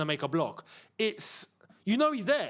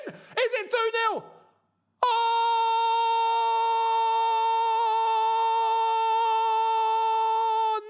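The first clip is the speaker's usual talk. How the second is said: excited shouting; 4 dB louder, much slower than usual. Real, not acted. Goal scored during live match.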